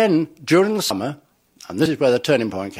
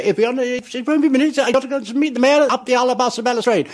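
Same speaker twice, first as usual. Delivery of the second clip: high comic voice; 12 semitones higher than usual. Retold or imitated. Mimicking his stage line, high.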